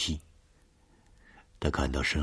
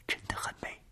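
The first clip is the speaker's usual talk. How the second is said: faint ghostly whisper; eerie whisper; 10 dB quieter, faster than usual. Acted. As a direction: breathe it out softly, eerie.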